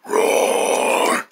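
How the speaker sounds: a soft, breathy, half-voiced roar; a timid roar that falls flat; weak roar. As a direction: roar under your breath, barely there. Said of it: "let me do that again".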